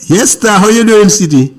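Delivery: loud, clear phone voice; speaking up. Real, not acted. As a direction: raise your voice for a bad line. Caller struggling to hear host.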